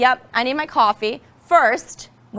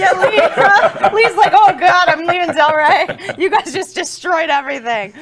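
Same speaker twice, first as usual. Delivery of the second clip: laughing; 6 semitones higher, 8 dB louder than usual. Real, not acted. Joking about Lee leaving.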